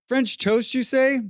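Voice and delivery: high, theatrical "French toast, you say?"; mock posh inquiry. Teasing her own food craving, a high theatrical quip. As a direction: toy with the words like a dandy. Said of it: "I'm like".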